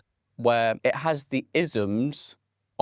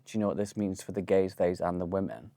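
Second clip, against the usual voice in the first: usual speaking voice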